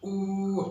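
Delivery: "ऊ" drawn out long. Shown as a demonstration. Teacher shows the long ऊ sound to students. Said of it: "long sound कहें तो वो बनेगी ऊ".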